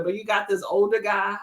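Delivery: ordinary delivery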